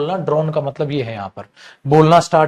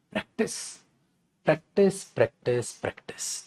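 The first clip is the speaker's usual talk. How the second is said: Practice repeated, softer each time until whispered; fading to a whisper; 11 dB quieter than usual. Acted. Teacher urging students to practice, fading into a whisper. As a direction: let the word die away into a breath.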